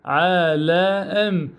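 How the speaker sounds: عالم stretched out very slowly; very slow drawn word. Shown as a demonstration. Teacher stretching عالم for pupils, very slow. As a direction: hold every sound of the word. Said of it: "نلفظ بهذا الشكل".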